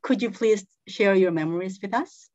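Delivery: normal talking voice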